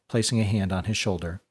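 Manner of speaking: plain reading voice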